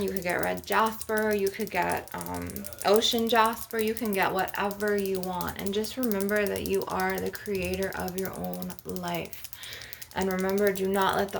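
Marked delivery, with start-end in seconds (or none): none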